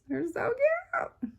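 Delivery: very high, drawn-out cutesy squeal; gushing. Real, not acted. Showing July patterns to viewers, high gushing coo.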